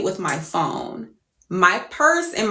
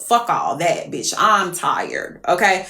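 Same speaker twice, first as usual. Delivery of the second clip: ordinary talk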